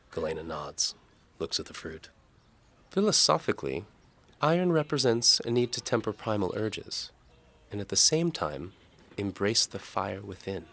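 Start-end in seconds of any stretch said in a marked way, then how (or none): 2.9-10.7 higher, louder lightly voiced young woman; Galena's voice. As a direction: voice the maid brightly and politely. Galena philosophizes.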